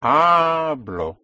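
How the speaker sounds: "Hablo" with a long, loud first A; pushed-down stress. Shown as a demonstration. Teacher modelling "I speak", "Hablo" stressed long and loud on HA. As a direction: lean hard on HA, then fall to BLO in "Hablo". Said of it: "push down".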